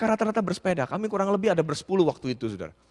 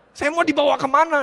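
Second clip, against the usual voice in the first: alarmed question; 6 semitones higher, 6 dB louder than usual. Retold or imitated. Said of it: "saya bilang".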